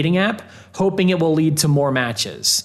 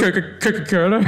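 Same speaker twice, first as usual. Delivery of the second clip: mocking the funny Coca-Cola; 4 semitones higher than usual. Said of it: "they say it all funny like that".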